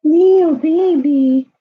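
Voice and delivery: high, slow, loud cooing to a pet. Real, not acted. Baby talk to the cat.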